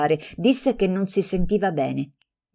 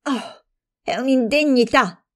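much higher, shocked and indignant; outraged exclamation; 8 semitones higher than usual. Acted. Offended friend exclaiming at the slander, outraged. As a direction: cry out at the insult. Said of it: "esclamò la Pedani".

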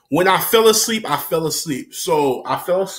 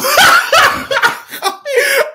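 high squealing laugh; loud, high cackling fit; helpless laughter; 6 dB louder than usual. Real, not acted. Storyteller cracks up at own confession, helpless laughter.

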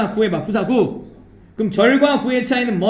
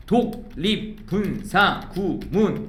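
slow, softer, with a break after each syllable; 4 semitones lower, 5 dB quieter, much slower than usual. Shown as a demonstration. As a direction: chop the term into separate syllables. Spells out the term syllable by syllable.

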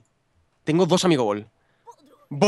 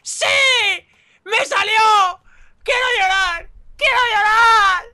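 real high-pitched tearful wailing; crying with joy; 13 semitones higher, 6 dB louder, much slower than usual. Real, not acted. Player who just got a Shiny, crying with joy. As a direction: shout through overjoyed tears. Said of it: "estoy llorando".

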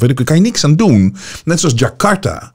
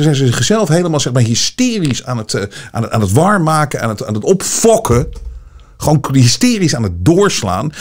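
ordinary talk, nothing marked

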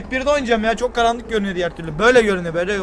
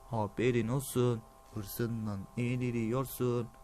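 eerie game voice; 10 semitones lower, 13 dB quieter, much slower than usual. Retold or imitated. Imitating eerie game voice, slow.

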